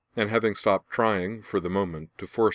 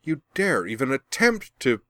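high, loud furious outburst cut off; raging; 7 semitones higher, 5 dB louder than usual. A narrator's reading. Blinded Azula raging at Laura as she tries to rise. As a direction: explode in fury, then break off. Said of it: "she raged".

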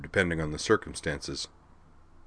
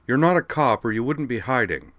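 raised, challenging voice; louder call; 6 semitones higher than usual. A narrator's reading. Uhtred calls up to the hidden man, raised. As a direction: call out the bluff. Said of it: "she declared in a louder voice".

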